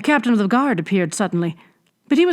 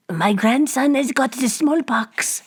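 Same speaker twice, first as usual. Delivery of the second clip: put-on slow, coarse old hag voice; 6 semitones higher, slower than usual. A narrator's reading. Old hag taunts Bibot with her trophy, coarse. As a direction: gloat like a ghoulish crone. Hag's voice.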